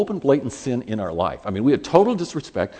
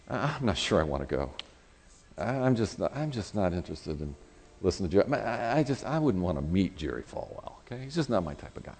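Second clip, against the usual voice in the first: reluctant excuse; 4 semitones lower, 9 dB quieter than usual. Retold or imitated. Acting out his reluctant excuse to wife.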